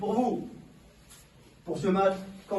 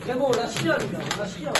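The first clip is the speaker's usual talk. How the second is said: high, urgent rallying cry; rally the squad before kickoff; barking gym coach; 4 semitones higher, faster than usual. Real, not acted. Coach rallying team before match.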